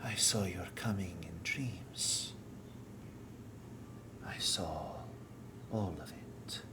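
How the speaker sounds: whispering entity voice. Acted. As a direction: breathe it like a voice inside their heads. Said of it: "a single voice whispering through your minds".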